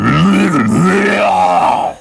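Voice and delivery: loud, high, drawn-out bear roar; hammed-up monster roar; grizzly roar. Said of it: "the thing goes".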